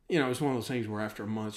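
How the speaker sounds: ordinary talk, nothing marked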